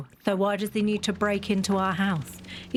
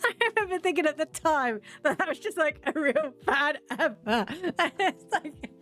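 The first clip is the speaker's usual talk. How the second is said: high, helpless laughing speech; laughing while talking; 6 semitones higher than usual. Real, not acted. Cracking up remembering a bad advert, really laughing. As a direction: talk through real giggles.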